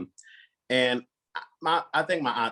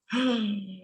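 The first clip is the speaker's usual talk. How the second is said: mock shocked gasp; 11 semitones higher than usual. Reacting to aunts' threat.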